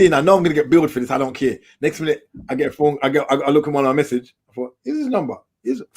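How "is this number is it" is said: puzzled high question; suddenly high and puzzled; surprised. Retold or imitated. Puzzled at unknown number message.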